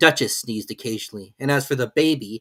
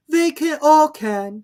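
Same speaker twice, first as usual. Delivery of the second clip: high, slow, imperious lady; grand and dismissive; Duchess; 9 dB louder, much slower than usual. A narrator's reading. Duchess figure voice.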